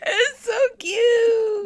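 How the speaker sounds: high, slow squeal of cuteness; gushing. Real, not acted. Gushing over a skunk.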